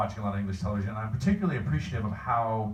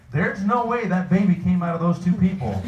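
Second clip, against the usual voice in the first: louder, higher retold protest; mock disbelief; 7 semitones higher, 6 dB louder than usual. Comic retelling his reaction to TV casting, mock-incredulous. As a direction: exclaim it like an exasperated TV viewer. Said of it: "I'd be like".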